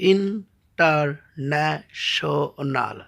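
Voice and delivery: "ইন্টারন্যাশনাল" slowly split as ইন, টার, ন্যা, শনাল; syllable by syllable. Shown as a demonstration. Teacher showing a polysyllable, saying "ইন্টারন্যাশনাল" in slow parts. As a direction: separate beats in "ইন্টারন্যাশনাল", pauses between. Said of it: "অধিক বার উচ্চারণ করতে হয়".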